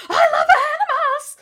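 high, very loud shriek through a laugh; mock scream. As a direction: shriek it half-laughing, mocking. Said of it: "It's just like".

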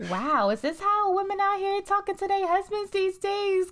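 high, incredulous mock outrage; shocked disbelief. Wife at home mocking rude wives, high shocked. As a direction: gasp it out like you can't believe your ears.

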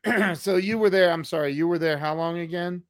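ordinary talk

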